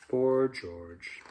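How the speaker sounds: usual speaking voice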